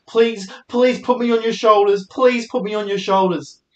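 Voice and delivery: high-pitched mock begging; pleading. Mimics girl begging guys.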